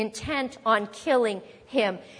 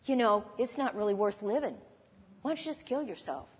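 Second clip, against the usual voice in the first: softer, hushed devilish suggestion; tempting devil; 7 dB quieter, faster than usual. Pretend. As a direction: whisper the temptation close.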